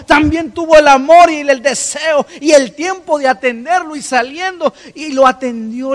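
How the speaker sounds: high, loud, building sermon cry; full-force preaching. Acted. Pastor to congregation, loud fervent preaching. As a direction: preach it out to the church.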